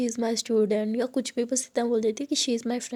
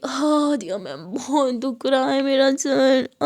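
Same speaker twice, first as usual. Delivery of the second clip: slow, louder whimpering complaint; crying; 5 dB louder, slower than usual. Acted. Drunk Muskaan to Aadiya, slow tearful whimper. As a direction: wail about the headache. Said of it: "वहीं पे रोने लगती है".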